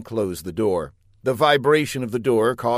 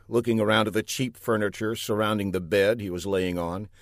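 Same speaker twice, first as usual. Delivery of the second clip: plain reading voice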